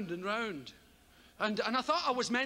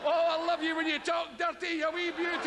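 higher, louder leering shepherd after bleats; bleat, then croon to the sheep; lusty shepherd; 6 semitones higher, 3 dB louder than usual. Retold or imitated. Shepherd crooning to his sheep, higher leering voice.

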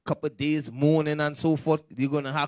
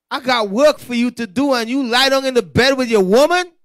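loud, raised-pitch divine reprimand; exasperated scolding; 5 semitones higher, 8 dB louder than usual. Acted. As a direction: chew out a slacking servant, loudly. Acts out God scolding Ezekiel.

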